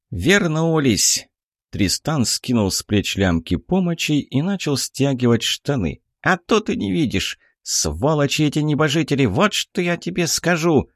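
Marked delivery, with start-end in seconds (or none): 0.1-1.3 high, slow exclaimed pixie voice; a small fairy crying out. Acted. 6.2-10.9 high, indignant pixie; a tiny fairy fuming. Acted. Pixie rants about gods.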